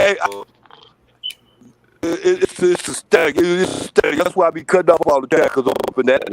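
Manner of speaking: loud, distorted static-filled line; robotic choppy bad connection; scrambled voice. Real, not acted. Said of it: "You sound like a robot".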